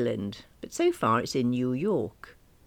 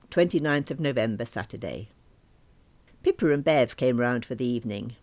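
usual speaking voice

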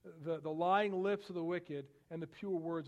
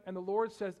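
ordinary delivery

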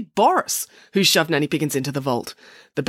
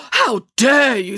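loud, slow, higher-pitched indignation; outraged; 7 semitones higher, 6 dB louder, slower than usual. Acted. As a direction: stretch it out in affronted outrage. Nanny is grabbed and shoved.

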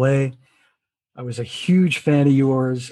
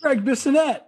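excited greeting; 12 semitones higher than usual. Real, not acted. Host greets arriving guest.

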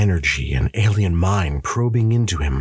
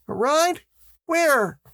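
put-on very high child's voice; young boy; slower than usual. A narrator's reading. Son asking his dad what is up, boyish. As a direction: ask as a little son.

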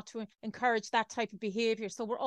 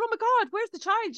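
much higher and louder, panicked mother; frantic panic; 13 semitones higher, 7 dB louder than usual. Retold or imitated. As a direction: blurt it out in a frantic rush of fear. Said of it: "was panicking".

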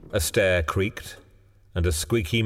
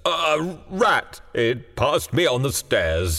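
put-on high, frightened old man slowing as he explains; shaking tones; 7 semitones higher, slower than usual. A narrator's reading. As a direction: tremble through a nervous excuse, starting high. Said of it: "said the old man in shaking tones".

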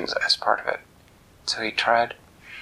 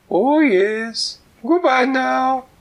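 very high, loud and slow silly goose; 6 dB louder, much slower than usual. Acted. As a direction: coo it like a giddy goose. Goose leaves.

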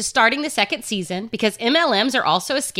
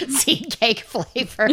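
laughing; slower than usual. Real, not acted. Cracking up at silly flavor joke.

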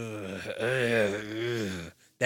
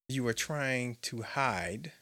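ordinary talk, nothing marked

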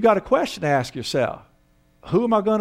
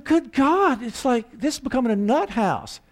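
exasperated husband; 7 semitones higher than usual. Retold or imitated. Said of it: "He's going".

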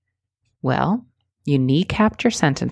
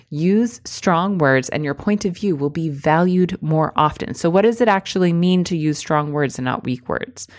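normal talking voice

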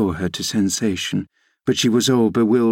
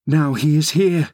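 higher-pitched urgent announcement; alarmed exclamation; 6 semitones higher than usual. A narrator's reading. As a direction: sound the alarm, voice raised in pitch. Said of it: "I exclaimed".

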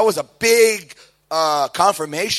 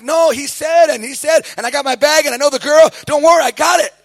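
stubborn insisting; 10 semitones higher, 7 dB louder than usual. Retold or imitated. His younger self insisting, voiced.

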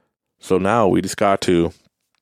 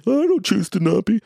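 much higher, mock defensive viewer voice; mock objection; 13 semitones higher than usual. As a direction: parody a listener's protest.

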